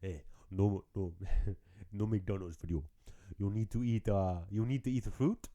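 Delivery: a hint of an African doctor lecturing; African accent. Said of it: "I'm trying to do African".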